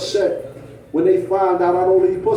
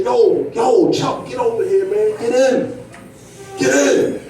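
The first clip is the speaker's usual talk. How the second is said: calling out; 5 semitones higher than usual. Retold or imitated. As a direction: hail him over to the car. Friends calling him from the car.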